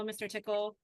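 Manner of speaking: normal talking voice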